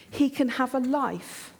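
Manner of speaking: normal talking voice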